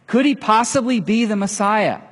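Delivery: excited telling. Acted. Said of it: "ran back to the village, telling everyone".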